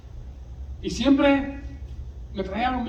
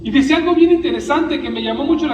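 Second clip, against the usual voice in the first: louder, higher, fast fiery preaching; full-force preaching; 5 semitones higher, 7 dB louder than usual. Acted. Preacher to congregation over music, preaching at full force. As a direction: fire up the congregation.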